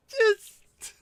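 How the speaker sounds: very high, loud squealing laugh that breaks off the word; burst of laughter. Real, not acted. Streamer cracking up at a friend's old nickname, squealing laughter. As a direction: laugh helplessly at the memory.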